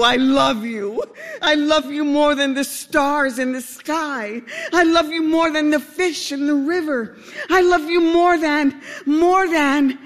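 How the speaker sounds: high, pleading declaration of love; lovestruck duck. A narrator's reading. Lovesick duck pleading with a rock, high and aching.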